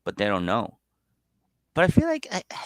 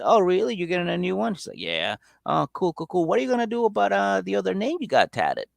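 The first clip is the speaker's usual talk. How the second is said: much higher, needling imitation of the sister; mock sarcastic sister; 9 semitones higher than usual. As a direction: poke at him in a high sarcastic voice. Sister needling him, retold.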